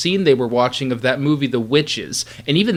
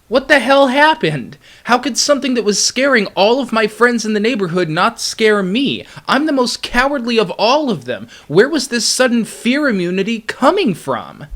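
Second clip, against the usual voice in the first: amazed disbelief; 7 semitones higher than usual. Real, not acted. Recalling baffled surprise.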